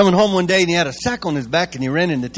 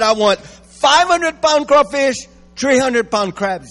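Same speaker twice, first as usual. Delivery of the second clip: Boudreaux ordering; 9 semitones higher, 5 dB louder, slower than usual. Acted. Boudreaux ordering at fish market.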